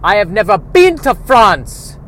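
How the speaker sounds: put-on slow, high, shouted Dutch accent; bad Dutch accent. Dutchman talking to a Frenchman, shouted Dutch accent. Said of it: "Here's a Dutchman talking to a Frenchman".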